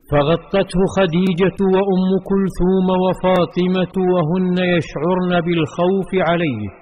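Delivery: ordinary delivery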